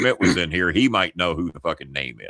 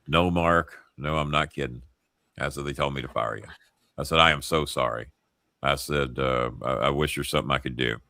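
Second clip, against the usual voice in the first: low, soft apologetic delivery; sincere, sombre; 6 semitones lower, 5 dB quieter than usual. Retold or imitated. Friend telling Mark he is fired, sincere and sombre. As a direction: let the voice sink as you apologise. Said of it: "I'm pitching it to him just like I'm doing with you".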